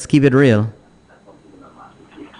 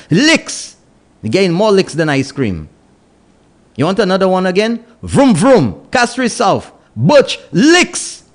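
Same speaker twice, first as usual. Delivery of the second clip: rally the listeners like a stage MC; shouting election predictions at the listeners; fired-up radio hype; 11 semitones higher than usual. Acted. Radio host hyping election predictions.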